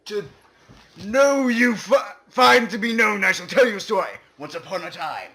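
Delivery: ordinary talk, nothing marked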